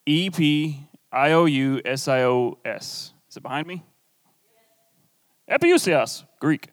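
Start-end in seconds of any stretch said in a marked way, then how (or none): 0.1-3.1 slow letter-by-letter spelling. Shown as a demonstration. Pastor spelling the word for note-takers slowly. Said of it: "need help spelling it?".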